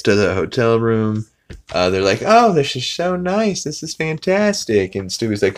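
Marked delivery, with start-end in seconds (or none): 2.2-4.9 much higher, gushing mock delight; delighted family. Family admiring the hotel room, much higher mock delight. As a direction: gush over the room in a high voice.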